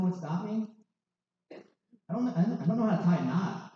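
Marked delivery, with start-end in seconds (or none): none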